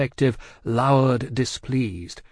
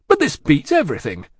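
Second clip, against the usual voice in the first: loud, higher, indignant; forceful offended outburst; angry disbelief; 4 semitones higher, 4 dB louder than usual. A narrator's reading. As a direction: protest in blustering outrage. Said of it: "the angry Stryver".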